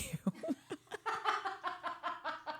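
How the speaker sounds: high, soft run of giggles; giggling. Real, not acted. Host cracking up at own crude joke, giggling. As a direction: dissolve into quiet laughter.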